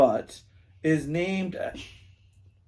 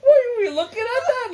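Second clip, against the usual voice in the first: high squeaky laughter through words; can't stop laughing mid-sentence; laughing hard; 5 dB louder than usual. Real, not acted. Laughing fit over the joke.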